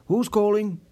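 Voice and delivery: curt, irritated phone greeting; impatient, put-out hello; annoyed. A narrator's reading. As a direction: snap it out, bothered by the ringing. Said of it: "sounding quite annoyed".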